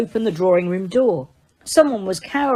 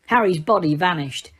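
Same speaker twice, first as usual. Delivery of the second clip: plain reading voice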